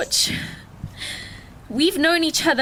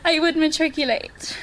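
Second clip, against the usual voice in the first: slow, high, half laugh half cry; tearful laugh at own emotion; laughing through tears; 6 semitones higher than usual. Real, not acted. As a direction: giggle as the tears come. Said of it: "I'm already crying".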